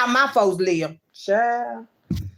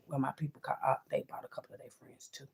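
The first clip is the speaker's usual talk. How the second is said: softer, hushed, menacing whisper; warning whisper; 8 semitones lower, 16 dB quieter than usual. Real, not acted. To enemies, a hushed threatening whisper.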